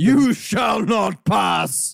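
slow, booming wizard's shout; Gandalf. Acted. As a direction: bellow it slowly, high and commanding. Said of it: "Gandalf standing there".

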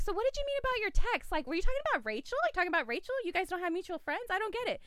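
high, rapid string of baffled questions; confused questioning. Retold or imitated. Woman quizzing the caller about his text, confused questioning.

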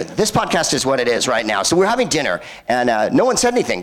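ordinary talk

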